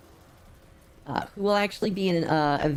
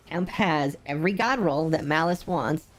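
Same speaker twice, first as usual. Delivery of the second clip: usual speaking voice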